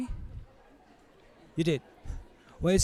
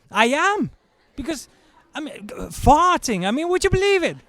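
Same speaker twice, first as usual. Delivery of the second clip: much higher, fast disbelieving rant; incredulous rant; 11 semitones higher than usual. Real, not acted. Comedian to audience, incredulous and high. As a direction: ramp up the disbelief.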